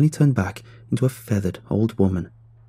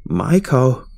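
louder, controlled yell of a name; raised-voice summons; yelled call; 3 semitones higher, 5 dB louder than usual. A narrator's reading. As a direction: call out firmly, then let it fall. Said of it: "she yelled again".

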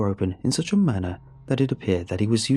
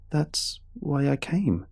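put-on slow, higher, uneasy boy voice; trailing off; 8 semitones higher, slower than usual. A narrator's reading. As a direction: let the words fade in dread. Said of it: "I trailed off".

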